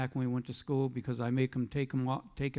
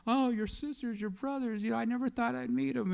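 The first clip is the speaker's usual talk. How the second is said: high, soft teary voice, a little hurried; mock the sobbing friend lightly; imitating a crying neighbor; 9 semitones higher, 3 dB quieter than usual. Neighbor Butch tearing up about meeting the siblings, high soft teary voice. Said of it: "he starts crying".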